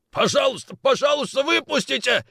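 high, loud desperate begging; panicked pleading. Acted. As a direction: plead through panic to be let out. Locked in hold, begging.

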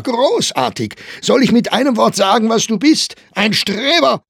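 very high, loud accusation; angry, scornful shouting. A narrator's reading. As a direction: shout the insult at a rival. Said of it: "rief Staufner". (wütend und höhnisch gerufen)

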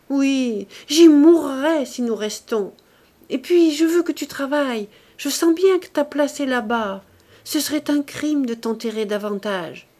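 high, loud, urgent entreaty, easing at the end; impassioned plea. A narrator's reading. Wife urging her husband back to his work, impassioned plea. Wife pleads to leave for Paris.

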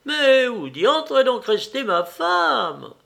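high, slow, sour little cry; a fussy little man calling for his wife, high and slow. Acted. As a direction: squeak it out like a peevish little husband. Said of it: "cria de sa petite voix aigre".

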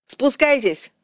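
high-pitched projected shout; shouted command through cupped hands; calling out. A narrator's reading. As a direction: call up to someone far away. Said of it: "выкрикнул Вальдекрис, сложив руки рупором".

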